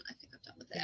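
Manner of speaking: whispered aside. Real, not acted. Whispering an aside to her podcast guest. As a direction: breathe it out as a whisper.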